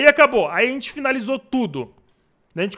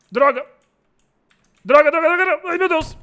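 loud, high repeated swearing; frustrated cursing; 8 semitones higher, 6 dB louder than usual. Real, not acted. Frustrated at the locked door.

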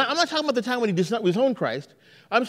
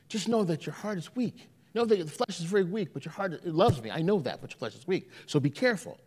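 softer, lower, tender warning; gentle Jesus; 5 semitones lower, 7 dB quieter than usual. Acted. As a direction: reassure weak disciples quietly.

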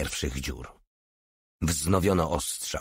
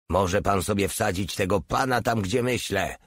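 usual speaking voice

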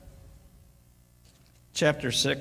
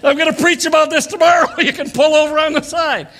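much higher, louder joking yell; laughing shout; 10 semitones higher, 9 dB louder than usual. Retold or imitated. Grandpa shouting to the kid in the back, laughing. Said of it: "I said".

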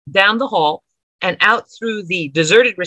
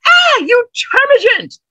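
high, loud scalded shriek; a high, loud cry of pain; 5 dB louder than usual. Acted. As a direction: shriek it as boiling water hits his neck. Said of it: "He shrieked at the top of his lungs".

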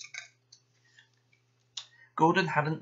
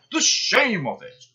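grumbling; 7 semitones higher, 6 dB louder than usual. Acted. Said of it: "he grumbled".

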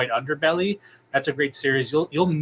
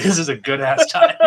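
laughing words, louder; 3 semitones higher, 6 dB louder than usual. Real, not acted. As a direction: giggle through the line. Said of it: "laughing while speaking".

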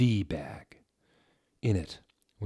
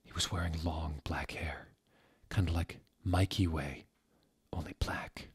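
soft unvoiced whisper; whispered swoon; 2 semitones lower, 6 dB quieter than usual. Narrator whispering about a crush, soft and unvoiced. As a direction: breathe it like a secret crush.

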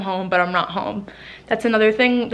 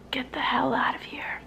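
whispered; 2 semitones higher, 9 dB quieter than usual. Real, not acted. Joking aside about leaving military.

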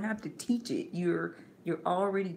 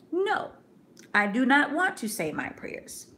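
put-on stubborn child; 8 semitones higher, 4 dB louder than usual. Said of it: "saying repeatedly".

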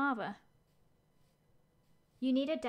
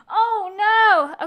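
loud, high burst of alarm; alarmed cry; 8 semitones higher, 14 dB louder than usual. Real, not acted. Reacting to a game mishap, loud alarmed cry. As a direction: yelp it out at the mistake.

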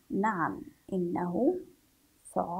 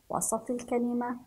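normal talking voice

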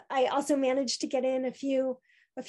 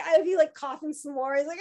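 usual speaking voice

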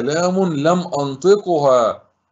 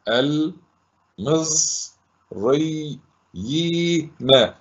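syllable by syllable; 3 semitones higher, much slower than usual. Shown as a demonstration. Teacher dictating how to write the word, syllable by syllable. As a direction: sound it out piece by piece. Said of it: "أكتبها كما نطقت".

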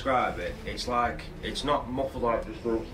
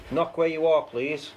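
higher and louder call to the room; 5 semitones higher, 4 dB louder than usual. Real, not acted. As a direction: call out firmly to the spirit. Calling out to the spirit.

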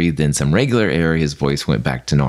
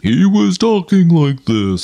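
much deeper, slow imitation of a gas voice; 13 semitones higher, 5 dB louder, slower than usual. Shown as a demonstration. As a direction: drop the voice way down like after the gas. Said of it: "made his voice go really deep".

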